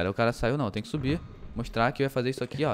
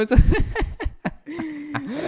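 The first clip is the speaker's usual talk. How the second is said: high laugh; 11 semitones higher, 3 dB louder than usual. Real, not acted. Laughing at own remark.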